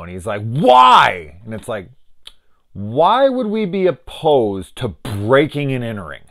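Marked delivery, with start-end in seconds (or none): 0.4-1.4 loud, high-pitched cry of disbelief; exaggerated, booming "why"; mock incredulous. Acting out his reaction to break-in idea. 2.7-6.2 slow, high-pitched sarcastic question; exaggerated mocking bafflement; mock incredulous. Mocking a character's obliviousness.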